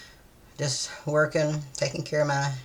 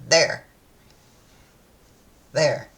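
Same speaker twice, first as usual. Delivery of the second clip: put-on louder, pressing child's insistence; a kid sure she's right, louder; insistent little girl; 3 semitones higher, 6 dB louder than usual. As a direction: jab the word like pointing at it. Kid insisting, pointing at word.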